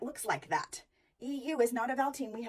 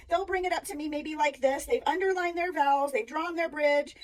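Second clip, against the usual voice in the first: ordinary delivery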